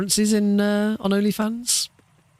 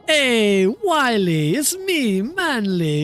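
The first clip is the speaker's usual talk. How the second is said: higher, cocky cartoon coyote hailing his cousin; lightly voiced cartoon coyote, a bit higher and slower; Manly Coyote voice; 5 semitones higher, slower than usual. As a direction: play the smug, successful cousin showing off. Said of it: "Manly Coyote sounds like Wile E. Coyote's really successful cousin".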